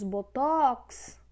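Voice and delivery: boTÓÓX, TOX long and pushed; stress on last syllable. Shown as a demonstration. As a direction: slowly lean hard on the end of botox. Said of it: "também está na última sílaba".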